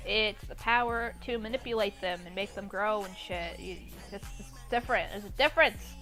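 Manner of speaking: usual speaking voice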